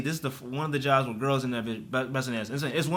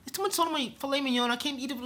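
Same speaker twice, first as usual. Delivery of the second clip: prissy customer, much higher and quicker; whiny rich customer; 10 semitones higher, 3 dB quieter, faster than usual. Retold or imitated. Rich customer whining to staff about too much salt, high and fussy. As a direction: fuss over the salt like a spoiled diner. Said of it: "He's like".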